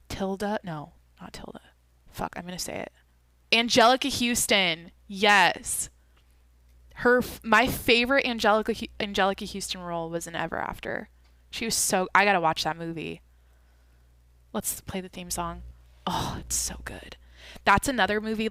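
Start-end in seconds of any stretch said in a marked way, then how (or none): 0.6-2.9 breathy whisper to herself. Real, not acted. Searching her memory, she whispers to herself. 16.0-17.2 whisper. Real, not acted. Savoring the theme song.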